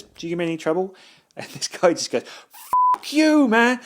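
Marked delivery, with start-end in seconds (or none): 0.0-0.9 lightly acted, higher-pitched question; raised-pitch retold query; Robert De Niro. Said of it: "Robert De Niro says". 2.9-3.8 very high, loud mock outburst; comic outburst. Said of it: "says it in such a funny way".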